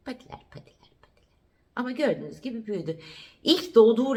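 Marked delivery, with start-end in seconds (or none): 0.1-1.3 breathy whisper to the puppy, barely audible; hushed whisper. Real, not acted. Owner whispering to her puppy about its paws, soft whisper. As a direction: whisper the word patiler like a secret.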